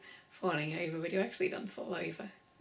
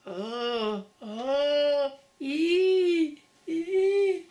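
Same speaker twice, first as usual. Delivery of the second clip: oh and ee climbing much higher and louder; rising vocal sounds; 6 semitones higher, 10 dB louder, much slower than usual. Shown as a demonstration. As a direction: go up from oh to ee, building. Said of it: "you're gonna go".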